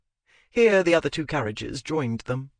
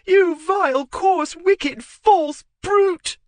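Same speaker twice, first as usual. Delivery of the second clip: very high, loud screaming insults; screamed insults; 8 dB louder, much slower than usual. Acted. Wife screaming insults at husband. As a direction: hurl each insult at full voice.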